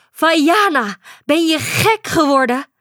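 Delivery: high, loud exasperated scolding; scolding mother. A narrator's reading. Mother scolding her daughter for swimming in the storm, exasperated. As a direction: tell her off in disbelief.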